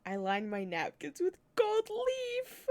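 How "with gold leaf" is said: higher, slow snooty aristocrat; pompous posh voice. Acted. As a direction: sneer it like a snob. Mocking pompous rich person.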